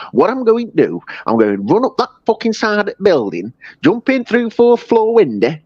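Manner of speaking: high-pitched mock English accent of an American comedian; hammed-up English accent. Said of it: "this American guy doing that accent".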